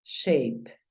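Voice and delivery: shape said "sheip", ei glide, falling; English shape. Shown as a demonstration. Teacher tells the learner how to say shape, English sounds. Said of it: "Entonces tú dices".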